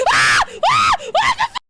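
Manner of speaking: high, loud shrieking; terrified scream. Real, not acted. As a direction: scream as it grabs you. Attacked in horror game.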